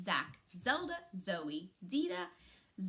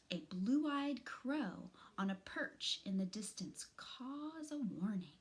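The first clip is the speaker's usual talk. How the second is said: usual speaking voice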